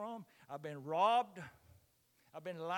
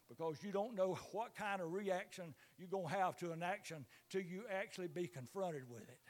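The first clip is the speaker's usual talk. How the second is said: normal talking voice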